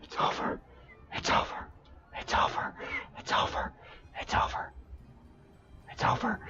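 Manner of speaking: whispered over and over in shock; stunned whisper. Real, not acted. Streamer with child right behind, stunned whisper. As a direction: repeat it in an urgent, voiceless whisper. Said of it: "my child is right behind me".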